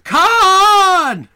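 long, high, loud drawn-out yell; scream. Acted. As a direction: scream the name skyward at full voice. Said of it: "I would scream".

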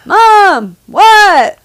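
loud, high shouted reply; yelling back to a kid from another room; mock yell across the house. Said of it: "our house not to be like".

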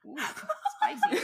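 high, slow, laughing ooh; mock scandalized. Real, not acted. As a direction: tease it like juicy gossip. Mock reaction to grandma.

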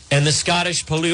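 ordinary delivery

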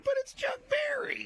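a breathy whispered aside, high; awed whisper. Retold or imitated. Storyteller tells hosts of meeting a star, whispers in awe. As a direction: whisper it like a secret you can't believe.

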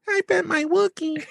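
very high-pitched cartoon child; squeaky, laughing kid impression; Ralph. Co-host answering with a Simpsons line, high Ralph voice. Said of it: "Who are you trying to do from The Simpsons?".

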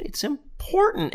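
high, slow, drawn-out insistence; mock-earnest high. Host stresses the ad point in a silly high voice. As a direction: stretch it out like a silly ad.

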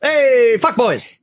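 high, loud hype greeting; welcoming the listeners, loud and high; show intro. Real, not acted. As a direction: punch the opener like a host. Podcast show opening greeting.

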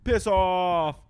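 high, loud exclamation with a falling end; shouted insult. Acted. Guessing how the British say it, shouted. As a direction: holler it as a mock dismissal. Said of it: "if they say".

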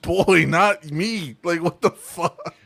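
normal talking voice

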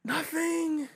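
high, incredulous squeak; stunned disbelief. Retold or imitated. Retelling his own reaction when nobody spoke up, high and incredulous. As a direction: act baffled that nobody did anything.

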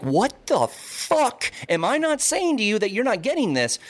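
high, slow, exasperated venting; drawn-out mock exasperation; frustrated outburst. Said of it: "my frustration gets the better of me".

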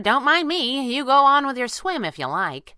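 high, loud, offhand teasing; breezy teasing. A narrator's reading. She teases him standing in water, breezy.

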